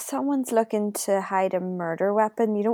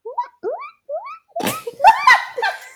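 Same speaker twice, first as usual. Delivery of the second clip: guinea pig voice; 4 dB louder than usual. Pretend. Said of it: "Can you do a guinea pig noise?".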